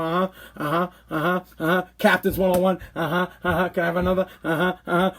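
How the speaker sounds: low, soft absent uh-huhs; fake listening. Husband half-listening to a buddy, droning absent uh-huhs. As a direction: drone along without listening. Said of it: "pretending like I'm listening".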